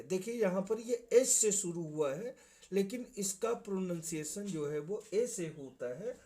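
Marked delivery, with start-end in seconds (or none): none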